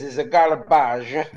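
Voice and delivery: loud, slow, laughing Italian "a garbage"; Italian accent. Retold or imitated. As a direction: quote the waiter, laughing. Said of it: "he says".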